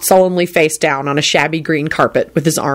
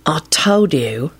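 weary, breathy and slow; bored sigh; slower than usual. A narrator's reading. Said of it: "sighed a bored voice".

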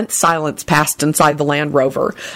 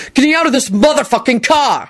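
pitched-up furious outburst; angry shout; 7 semitones higher than usual. A narrator's reading. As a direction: shout it in exasperation. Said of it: "he shouted".

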